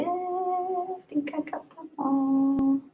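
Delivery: soft, high, drawn-out singsong. Real, not acted. Streamer done checking super chats, soft high singsong. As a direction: stretch it out high and softly.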